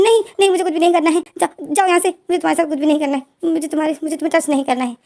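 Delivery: frantic yell from under the blanket; panicked shout. A narrator's reading. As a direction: push him away with a frightened yell. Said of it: "ज़ोर से चीख मारता है".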